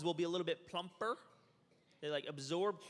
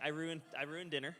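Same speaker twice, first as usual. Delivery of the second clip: ordinary delivery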